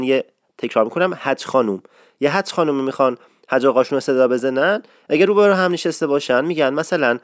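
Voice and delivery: usual speaking voice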